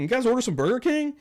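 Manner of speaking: high-pitched questioning call; delivery driver. Retold or imitated. Second Uber driver hailing the group, high mock call-out. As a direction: imitate a driver checking an order, voice up.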